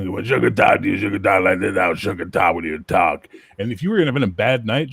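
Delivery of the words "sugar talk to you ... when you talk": loud, cartoonish boxer-pimp voice; imitating a cartoonish bouncer. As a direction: play him like a cartoon tough guy, slowing at the end. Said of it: "he sounded like a cartoon".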